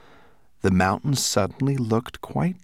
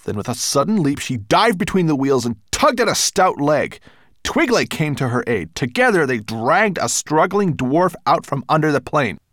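louder, higher action-scene narration; dramatic story narration; 7 semitones higher, 6 dB louder than usual. A narrator's reading. Narrator telling of the fight with the dwarf, excited narration. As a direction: drive the struggle along with urgency.

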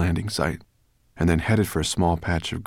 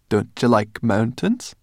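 slow, higher-pitched tease; sarcastic; 5 semitones higher, 4 dB louder than usual. A narrator's reading. Sorrel teasing Ben at the edge, sarcastic. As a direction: draw out the mock question. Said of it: "asked Sorrel sarcastically".

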